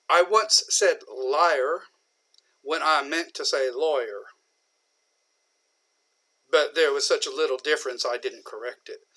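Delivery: slow, relaxed Southern drawl, lightly put on. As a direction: drawl it out slowly like a folksy storyteller. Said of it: "Lincoln talked with a bit of a drawl".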